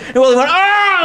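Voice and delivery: high, loud scream of fright. Acted. Willie screaming as the T. rex chases him. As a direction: shriek as the dinosaur comes. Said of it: "like that".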